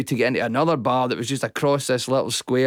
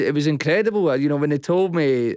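ordinary talk, nothing marked

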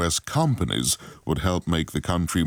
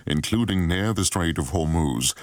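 news read; 2 semitones higher than usual. Acted.

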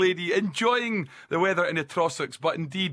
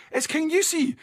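higher, incredulous mock outrage; exasperated; 9 semitones higher than usual. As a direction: act baffled that anyone says it that way. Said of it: "and it's like".

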